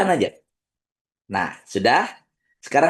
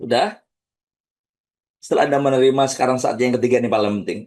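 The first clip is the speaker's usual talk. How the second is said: normal talking voice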